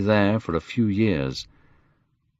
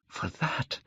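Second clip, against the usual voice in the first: near-whispered, almost voiceless; hushed longing; 4 semitones higher, 13 dB quieter than usual. A narrator's reading. Dorian wishing to stay young, hushed. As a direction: breathe the words out very quietly.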